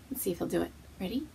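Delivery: normal talking voice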